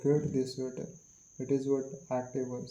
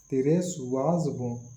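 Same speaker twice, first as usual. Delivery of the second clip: "race" drawn out and louder; stress on race; 4 dB louder than usual. Shown as a demonstration. Teacher showing which word has focus, stress on "race". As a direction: slowly lean on "race" in the phrase. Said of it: "we are emphasizing the word the race".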